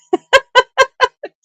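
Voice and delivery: a high, bright burst of giggling; high giggle. Real, not acted. Seller laughing at her own bee joke, giggling.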